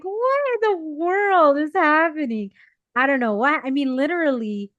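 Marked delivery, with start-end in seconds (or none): 0.0-2.5 higher, slower, bewildered; retold disbelief. Recounting her shock at the pharmacy counter, bewildered and slow. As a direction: ask it like you can't believe your eyes. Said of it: "And I'm like".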